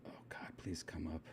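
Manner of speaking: very soft, low muttering; real half-whispered, low pleading to oneself; muttering to self. Real, not acted. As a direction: mumble under your breath while searching. Mutters to self while searching.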